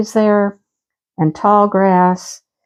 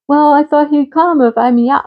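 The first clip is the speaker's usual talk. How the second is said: put-on kid's voice; 6 semitones higher than usual. Mom voicing her kid.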